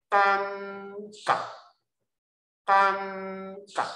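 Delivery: 感 high and drawn out, 觉 stopped short; long then short. Shown as a demonstration. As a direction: hold 感 high, then cut 觉 off. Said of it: "长音、短音哦".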